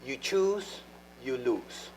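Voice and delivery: ordinary talk, nothing marked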